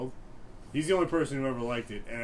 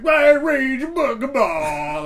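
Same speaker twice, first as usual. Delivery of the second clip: very high, loud, slow gibberish cry; Bill Cosby impression; 16 dB louder, much slower than usual. As Cosby on the quiz show, high slow gibberish. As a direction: stretch out nonsense in a goofy squeal. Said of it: "that was literally what I'd do as Bill Cosby".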